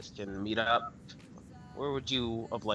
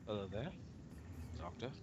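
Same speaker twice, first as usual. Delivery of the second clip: creepy vulture croak; 10 dB quieter than usual. Acted. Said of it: "Vulture's voice".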